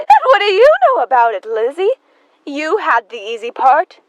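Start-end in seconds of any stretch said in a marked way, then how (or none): 0.0-1.9 loud, fast and high comeback; angry retort. Acted. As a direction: throw it straight back. Sister shouts back.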